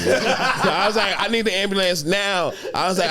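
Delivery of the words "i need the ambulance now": high, urgent mock plea. Begging his girlfriend for an ambulance, high urgent. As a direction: beg for the ambulance.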